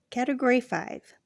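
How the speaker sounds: ordinary delivery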